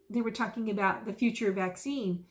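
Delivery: usual speaking voice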